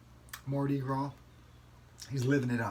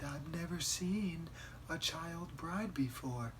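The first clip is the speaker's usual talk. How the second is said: mock innocent voice; 5 semitones higher, much slower than usual. Mocking the wives on TV.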